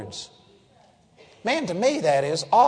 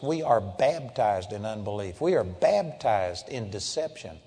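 low solemn; 9 semitones lower, 9 dB quieter than usual. Acted. Low solemn preaching lines.

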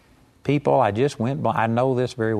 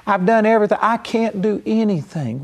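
higher, mock tearful complaint; imitating crying woman; 7 semitones higher than usual. Preacher retells a crying woman asking prayer, mock tearful. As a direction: mimic her helpless crying. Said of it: "She was crying".

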